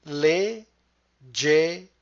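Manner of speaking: LEGGERE split into separate stretched syllables. Shown as a demonstration. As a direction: break "Leggere" apart, one syllable at a time. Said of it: "break down the word into syllables".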